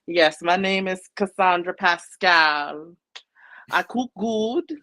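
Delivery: put-on slow, high Caribbean accent. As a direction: imitate a Caribbean accent from Precious. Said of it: "your Caribbean accent".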